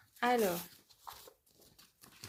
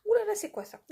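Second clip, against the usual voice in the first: ouh là là; 12 semitones higher, 6 dB louder, faster than usual. Real, not acted. Repeated, louder and higher.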